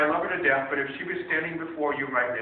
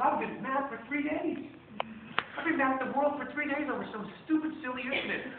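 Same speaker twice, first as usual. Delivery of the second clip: imitating the wife; 11 semitones higher than usual. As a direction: voice the wife owning up, lightly sheepish. Wife admitting her overreaction.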